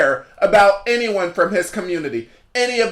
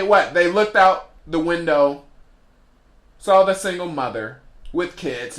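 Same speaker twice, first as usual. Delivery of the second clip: ordinary talk, nothing marked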